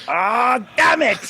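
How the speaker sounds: high, loud mock exasperation; caught Nazi, hammed-up. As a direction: curse like a comic villain who's been found out. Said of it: "imagine if you're that Nazi who's like".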